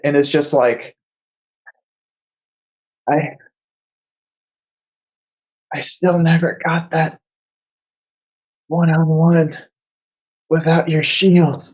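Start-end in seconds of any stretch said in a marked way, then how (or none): 3.1-11.6 slow, halting words with long gaps; wounded, drifting in and out as you speak; fading in and out. Acted. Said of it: "coming in and out".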